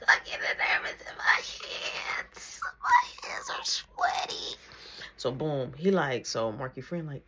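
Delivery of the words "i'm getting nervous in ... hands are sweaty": whispered, shaky nervous "I'm getting nervous in my hands". Retold or imitated. Reliving the nerves before the jump, shaky whisper. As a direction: breathe it out as if your hands are shaking.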